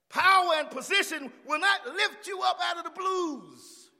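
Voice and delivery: high, loud shouted preaching; full-force preaching. Real, not acted. Preacher warning that money cannot help, shouting. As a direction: thunder it out, driving each phrase.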